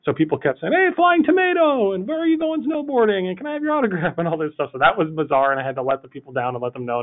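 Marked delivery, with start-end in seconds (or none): 0.7-4.1 much higher, gushing fan voice; high, eager mock fan chatter; imitating excited fans. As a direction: play a starstruck stranger spotting a celebrity. Fans greeting a supposed celebrity.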